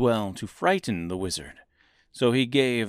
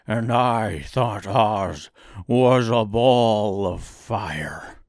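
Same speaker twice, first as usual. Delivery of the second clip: slow, growly lion then fading; 4 dB louder, much slower than usual. A narrator's reading. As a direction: rumble like the lion. Lion's voice.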